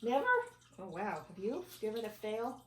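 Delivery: plain reading voice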